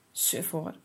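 low, soft hissed sulk through the lips. Acted. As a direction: mutter it under your breath, sulky. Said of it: "sist Hilde zacht tussen haar lippen".

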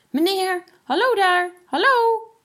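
high, loud hail across the lock; calling out. A narrator's reading. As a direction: shout to the man on the other boat. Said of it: "Hij begon te roepen". (luid roepend naar de andere boot)

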